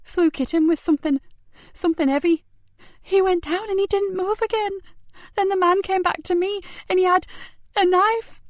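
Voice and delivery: put-on high, gulping, tearful boy's voice; near tears. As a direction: fight tears while telling it. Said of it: "gulping air and trying not to cry".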